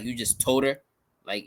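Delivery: ordinary delivery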